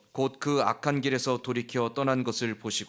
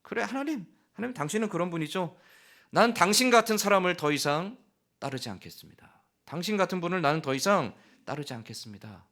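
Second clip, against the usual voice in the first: high sneer turning to defiant refusal; scoff, then declare you're done; sarcastic rejection; 5 semitones higher than usual. Acted. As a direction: Jonah spitting sarcasm and quitting on God. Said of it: "그런 의미예요".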